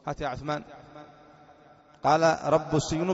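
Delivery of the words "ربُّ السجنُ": "رب" said as rabbu; damma on ba. Shown as a demonstration. Teacher points out the variant reading, "رب" as rabbu. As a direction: end "رب" on u before the next word. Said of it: "بضم الباء".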